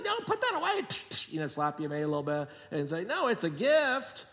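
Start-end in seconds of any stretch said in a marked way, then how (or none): 0.0-0.9 high, firm grandma voice; grandma refusing. Retold or imitated. Grandma refusing her grandkid's payment, high and firm. 3.0-4.2 grandma insisting. Retold or imitated. Grandma insists it's a gift.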